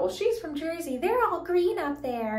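imitating mom. Retelling mom teasing the oil guy, mock mom voice. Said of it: "my mom says something about".

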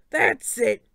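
loud, sharp coach yell. Acted. Coach yelling at the back row, loud. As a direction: snap it out at full volume. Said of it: "Coach Hedge yelled from the front".

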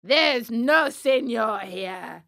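loud, slow ogre's rebuff; booming, stern puppet master; gruff refusal. Acted. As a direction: dismiss the beggar loudly, dragging the words. Fire Eater rebuffs the plea.

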